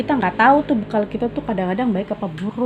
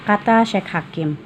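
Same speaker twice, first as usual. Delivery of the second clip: usual speaking voice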